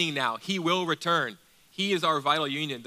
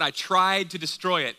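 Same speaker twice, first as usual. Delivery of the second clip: "tried" punched higher and louder; stress on TRIED; 5 semitones higher, 6 dB louder than usual. Shown as a demonstration. Preacher quotes Paul on attacking the church, stressing "tried". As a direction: hit "tried" hard, then fall away. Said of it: "Emphasis on tried".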